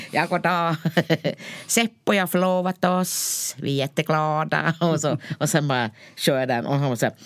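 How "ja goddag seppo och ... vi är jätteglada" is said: put-on announcing an engagement in a Tornedalen accent; Tornedalen-accented announcement. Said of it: "Nu blir det tornedalska".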